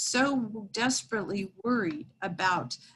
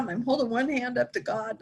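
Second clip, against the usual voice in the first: ordinary talk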